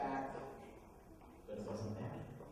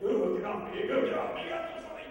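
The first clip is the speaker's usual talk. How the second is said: hammed-up squirming panic, leaping high; panicked protest; 5 semitones higher, 4 dB louder than usual. Little people crawl up Grumplebuffin's leg, acted panic. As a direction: yelp as if shaking off crawling things.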